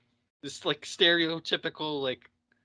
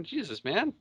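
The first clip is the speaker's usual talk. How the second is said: ordinary talk, nothing marked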